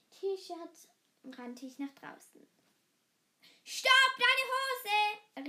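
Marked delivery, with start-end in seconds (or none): 3.6-5.5 high, loud alarmed shout; dad yelling about her trousers. A narrator's reading. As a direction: stop her at the door. Dad shouting after her again.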